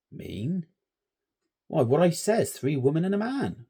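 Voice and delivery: shepherd explaining the cursed field; old rustic shepherd. A narrator's reading. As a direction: lecture the boys like an old farmhand. Shepherd insists.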